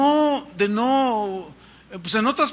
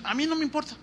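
mock dismissive worldling; 6 semitones higher, 4 dB louder, much faster than usual. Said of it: "dicen los del mundo".

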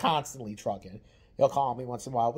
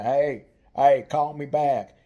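a much louder call-back demand on a voicemail; loud nagging message to her brother; 8 dB louder, slower than usual. Retold or imitated. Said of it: "You know, say".

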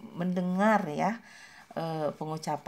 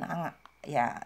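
ordinary delivery

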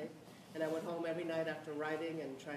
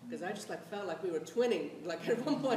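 normal talking voice

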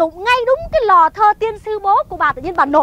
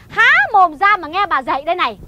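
ordinary delivery

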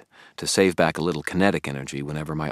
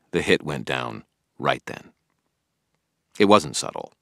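usual speaking voice

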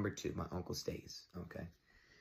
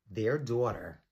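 usual speaking voice

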